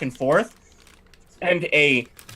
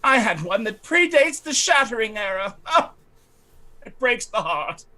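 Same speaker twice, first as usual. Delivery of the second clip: a slightly raised, haughty lady's voice; fussy noblewoman; 7 semitones higher than usual. Acted. Lightly raised voice as the lady fretting to the party about her estate. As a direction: play the put-upon aristocrat lamenting her estate. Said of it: "and she goes".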